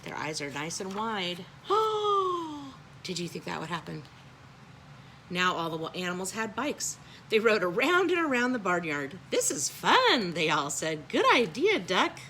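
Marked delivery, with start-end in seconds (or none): 1.5-3.0 a long, high mock gasp; surprised gasp. Reader gasps at surprise. 9.3-12.2 higher, louder, happy chorus; excited cheer. A narrator's reading. Animals cheer together.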